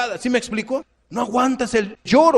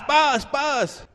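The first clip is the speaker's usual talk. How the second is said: mock slap sounds; 7 semitones higher than usual. Preacher mimics slaps on child.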